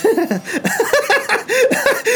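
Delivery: shrill high manic gnome cackle; gnome laugh. Acted. Gnome laughs at the challenge, high manic cackle. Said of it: "the gnome kinda begins to laugh".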